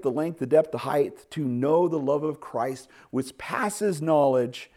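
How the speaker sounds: preacher soaring on the words; fervent scripture preaching. Acted.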